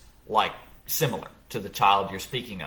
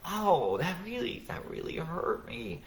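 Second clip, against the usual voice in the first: high, soft, wounded ow, ow; acting hurt; 8 semitones higher, 5 dB quieter, slower than usual. Father fakes being hurt to his angry son, high and soft. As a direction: play the hurt parent so the child notices. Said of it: "I would act hurt".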